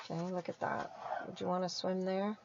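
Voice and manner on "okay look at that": ordinary delivery, nothing marked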